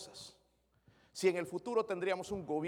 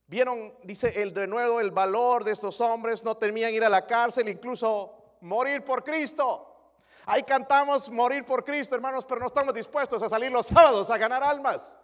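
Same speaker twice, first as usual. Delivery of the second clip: fiery preaching; 6 semitones higher, 9 dB louder than usual. Acted. Pastor rebuking the congregation, loud and high. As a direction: rise to a rebuke.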